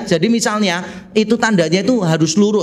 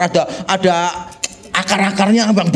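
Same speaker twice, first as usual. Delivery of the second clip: normal talking voice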